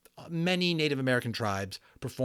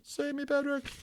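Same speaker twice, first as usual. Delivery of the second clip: pitched way up, thin and pleading, calling Patrick; high desperate plea; 3 dB quieter than usual. A narrator's reading. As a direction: cry out for Patrick to save you. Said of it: "Omri began screaming silently".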